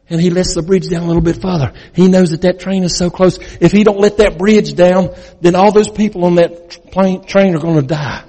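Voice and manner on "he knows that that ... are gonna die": normal talking voice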